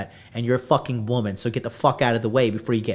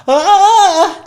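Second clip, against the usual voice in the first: high, loud gasping shriek; mock shriek of shock, very high and loud; 10 dB louder than usual. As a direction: shriek like you've been caught.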